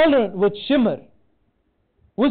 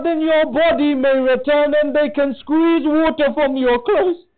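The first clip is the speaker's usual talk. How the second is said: rising impassioned lament; 5 semitones higher than usual. Acted. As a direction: lift the sermon into mourning. Sermon climbs to grieving climax.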